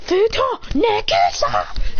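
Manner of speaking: high, drawn-out anime move shout; attack call. Acted. Fighter blasting his rival back, high drawn-out attack call. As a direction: stretch the attack name out high.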